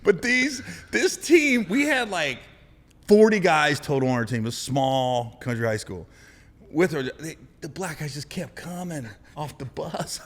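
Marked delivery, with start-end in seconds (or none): none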